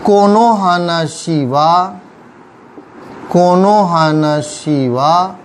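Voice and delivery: कोनो हानाशी वा spoken slowly and evenly; teacher's slow demo; slow model phrase. Shown as a demonstration. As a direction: lay out कोनो हानाशी वा word by word for students. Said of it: "teacher modelling Japanese phrase".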